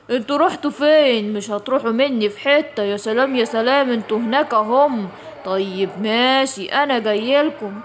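low, greedy predator voice savoring the meal; gloating hungry tiger. Acted. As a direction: relish the fat prey like a cartoon villain. Tiger hunts the kids. (نمر شرير جعان بيتلذذ)

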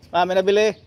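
calling out to Ma across the house. Real, not acted. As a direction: raise your voice so Mom hears from afar. Calls off-mic to his mother.

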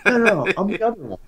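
protesting. Retold or imitated. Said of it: "I was like".

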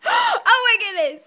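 high, loud delighted squeal. Real, not acted. Parent delighted at baby.

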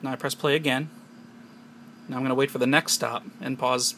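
ordinary talk

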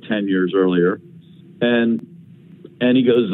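ordinary talk

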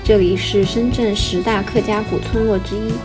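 ordinary talk, nothing marked

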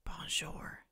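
soft unvoiced whisper. A narrator's reading. Shy Victor answering the teacher in a whisper. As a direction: breathe the word out timidly. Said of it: "Victor whispered".

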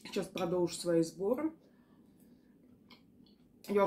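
talking between bites; speaking while eating pasta; mouth full, chewing. Real, not acted. Seen 3: Takes a forkful at 1.8s, chews while talking through about 3.7s.

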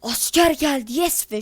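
loud, high burst of joy; yelling about the rare pet; excited. Real, not acted. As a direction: shout it out thrilled.

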